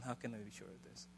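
doubting whisper. Retold or imitated. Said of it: "it's to stop asking".

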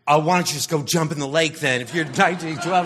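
loud, fast scornful snap; angry outburst. Acted. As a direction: suddenly blow up at her in disgust. Man turns on the girl.

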